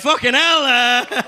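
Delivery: laughing exclamation. Real, not acted. Laughing disbelief at crowd.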